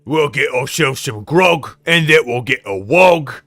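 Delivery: hammed-up orc war talk; British orc. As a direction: bellow it slowly like a Cockney orc. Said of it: "You guys know my opinion on orcs".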